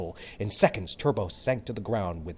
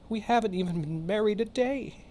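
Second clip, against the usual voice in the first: sobbing; 14 semitones higher than usual. Acted. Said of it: "he sobbed".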